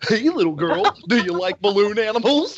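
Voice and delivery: high, loud creepy clown voice; silly clown. Acted. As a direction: lure them in like a creepy clown. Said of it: "That's a great clown voice".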